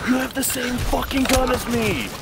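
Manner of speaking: high, furious yell at teammate; raging gamer. Real, not acted. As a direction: rant at a teammate. Angry gamer outburst.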